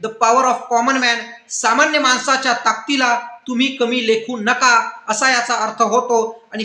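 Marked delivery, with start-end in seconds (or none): 0.0-1.2 quoted film line. Retold or imitated. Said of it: "एक dialogue आहे".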